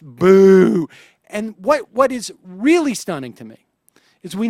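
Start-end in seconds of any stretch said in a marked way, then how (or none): 0.0-0.9 long, loud mock boo; imitated booing. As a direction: draw out a boo like a hostile crowd.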